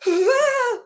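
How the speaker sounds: sudden high, loud "ah" cry; mock outburst. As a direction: imitate his loud outburst in the car. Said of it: "he's like".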